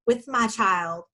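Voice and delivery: usual speaking voice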